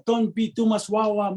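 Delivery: mock Tongan-accented fatherly advice. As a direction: imitate dad in a Tongan accent. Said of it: "I'll just say it in his accent".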